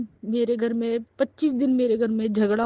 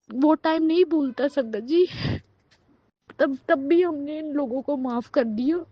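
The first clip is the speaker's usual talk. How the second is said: choked up, voice rising higher; real tearful upset; 4 semitones higher than usual. Real, not acted. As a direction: plead through welling tears. Recalls being thrown out, overcome. (रुआँसी, भरी हुई ऊँची आवाज़)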